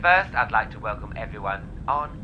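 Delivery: pilot announcement. Pretend. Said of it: "This is your captain speaking".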